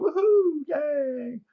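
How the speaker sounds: high, sarcastic little cheer; mock cheer. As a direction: cheer in fake delight.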